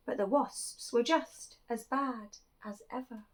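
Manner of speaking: put-on lively read-aloud for children; animated picture-book reading; storytelling voice. A narrator's reading. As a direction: tell it like a bedtime picture book.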